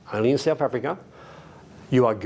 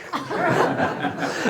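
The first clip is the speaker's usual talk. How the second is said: laugh. Real, not acted. Laughs at own punchline.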